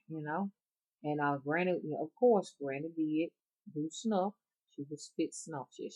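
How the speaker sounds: ordinary talk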